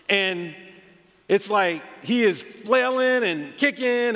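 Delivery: ordinary delivery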